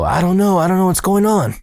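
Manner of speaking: high-pitched helpless protest; retold panic. Boy answering maintenance man about his voice, panicked.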